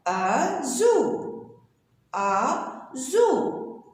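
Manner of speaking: ordinary talk, nothing marked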